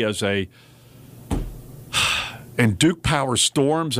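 usual speaking voice

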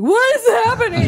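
high, loud, baffled cry; bewildered mugger. Retold or imitated. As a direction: play the stunned mugger. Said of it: "the guy would just be like".

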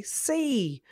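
See said high and bright; rhyming answer. Shown as a demonstration. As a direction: sing out the rhyme. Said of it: "someone else can say".

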